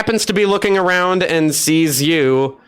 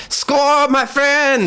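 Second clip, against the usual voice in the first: excited greeting; 10 semitones higher, much slower than usual. Acted. NPC greets Score loudly.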